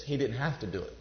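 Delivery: normal talking voice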